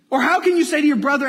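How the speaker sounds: quick, loud, animated delivery; lively vivid reading. Shown as a demonstration. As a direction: make the question leap off the page. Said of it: "Put it in your head".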